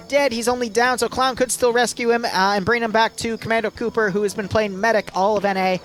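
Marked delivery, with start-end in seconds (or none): none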